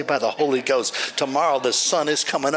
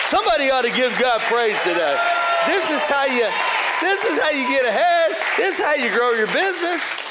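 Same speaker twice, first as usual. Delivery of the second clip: preaching at full force; 11 semitones higher than usual. Acted. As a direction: lift the whole room with it.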